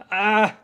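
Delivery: short, loud, high cry; acted scream. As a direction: let out a helpless scream. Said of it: "couldn't help but scream".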